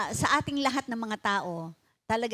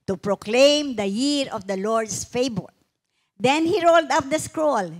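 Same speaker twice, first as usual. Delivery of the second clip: raised, ringing Bible reading to the congregation; scripture reading; 3 semitones higher, 4 dB louder than usual. Acted. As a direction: read the passage like a proclamation, slowing on key lines. Pastor reads Luke passage aloud.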